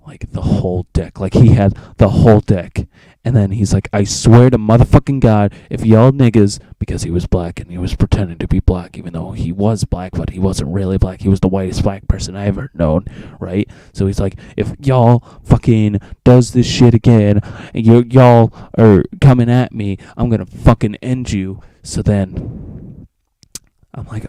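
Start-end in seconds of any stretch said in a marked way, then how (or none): 3.9-6.6 a touch louder, hammed-up fury; mock angry threat. Trevor threatening the table after drawing, mock rage. 14.6-21.6 a touch louder, hammed-up fury; mock angry threat. Trevor threatening the table after drawing, mock rage.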